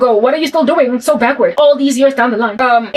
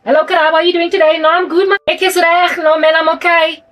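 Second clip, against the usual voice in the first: put-on higher, fast Cape coloured South African accent; 5 semitones higher than usual. Greeting Karabo as a coloured South African, in coloured accent. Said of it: "a lot of them have a colored accent".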